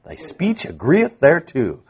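thy speech agreeth thereto repeated softly, agreeth relished; savoring repeat. Shown as a demonstration. Preacher softly relishing the verse for his congregation. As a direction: softly savour the old phrase. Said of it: "Don't you like that?".

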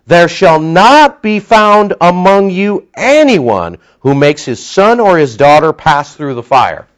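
slow, loud, lifted scripture reading; preaching scripture. Acted. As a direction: proclaim each word from the pulpit. Reads scripture with force.

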